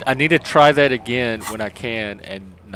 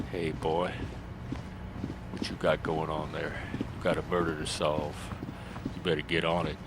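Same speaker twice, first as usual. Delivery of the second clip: low, soft daddy voice; 7 semitones lower, 10 dB quieter than usual. Acted. Daddy urging his boy to solve a murder, low voice. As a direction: drop down deep and quiet like a daddy. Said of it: "I could do a daddy. I could be daddy".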